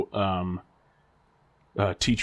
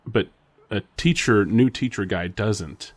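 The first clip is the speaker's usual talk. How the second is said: ordinary delivery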